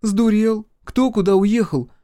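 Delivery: high, loud challenge; exasperated disbelief. A narrator's reading. Ранит disbelieves brother, exasperated. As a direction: scold as an incredulous older sister.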